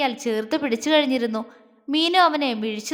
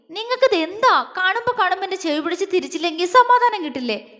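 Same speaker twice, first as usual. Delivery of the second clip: higher-pitched, quickening indignant protest; angry complaint; 6 semitones higher, faster than usual. A narrator's reading. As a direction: demand an answer angrily, voice raised in pitch. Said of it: "ദേഷ്യത്തോടെ ചോദിച്ചു". (ദേഷ്യത്തോടെ, ഉയർന്ന സ്വരത്തിൽ)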